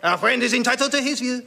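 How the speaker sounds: high, theatrical French peacemaker; French accent. Acted. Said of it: "in outrageous French accent".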